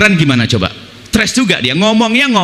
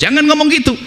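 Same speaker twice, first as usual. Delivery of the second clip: ordinary talk, nothing marked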